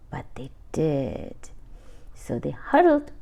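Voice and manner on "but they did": whispered aside; hushed reveal. A narrator's reading. Reader revealing brothers' secret help, whisper. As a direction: whisper the twist.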